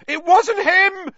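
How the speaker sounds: high, loud, slow roar. A narrator's reading. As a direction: roar it in desperate defence. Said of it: "roared the boy".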